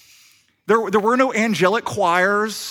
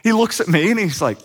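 ordinary talk, nothing marked

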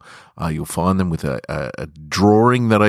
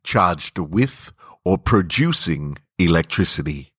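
intimate story narration. A narrator's reading. Podcaster reading his short story to listeners, hushed and intimate. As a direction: draw the listener close, savouring the tension. Reads his own fiction aloud.

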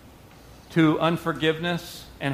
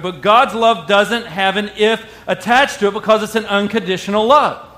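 usual speaking voice

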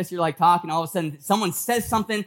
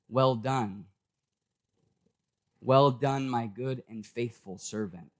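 low, soft words of approval; tender commendation; 7 semitones lower, 5 dB quieter than usual. Acted. Jesus commends Stephen as he stands for him, tender. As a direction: voice Jesus gently honoring him, pitch dropped.

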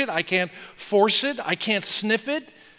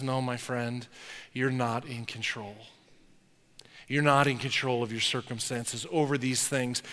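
low, much softer, tender correction; gentle reply; 6 semitones lower, 6 dB quieter than usual. Pretend. Jesus gently answering Nicodemus, low and tender. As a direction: tell him kindly, close and quiet.

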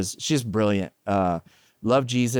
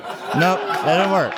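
laughing rejection; 6 semitones higher, 3 dB louder, slower than usual. Retold or imitated. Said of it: "It's like".

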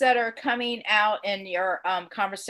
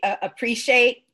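ordinary talk

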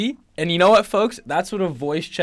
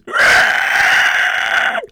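loud unvoiced screech; dinosaur screech; 10 dB louder than usual. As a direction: screech like a pterodactyl. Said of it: "just all goes".

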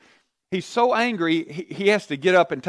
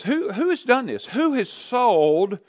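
king demanding; 6 semitones higher than usual. Acted. King voiced demanding who did it.

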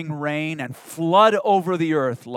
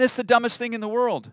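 ordinary delivery

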